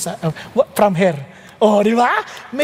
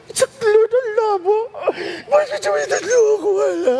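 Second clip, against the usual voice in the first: high-pitched, hammed-up wailing to God; mock sobbing complaint; imitated crying; 9 semitones higher than usual. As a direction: wail it out like someone bawling in prayer. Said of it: "Iyak siya, iyak".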